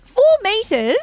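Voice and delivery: high, loud surprised question; amazed, pitch leaping up. Real, not acted. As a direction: react in real disbelief. Amazed at the waterproof depth.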